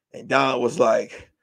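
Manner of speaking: ordinary talk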